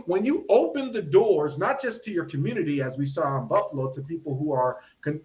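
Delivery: ordinary delivery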